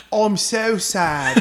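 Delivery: high, slow mock-sad British singsong; accidental British accent. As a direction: wail it as a hammy Brit. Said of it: "that sounded more British".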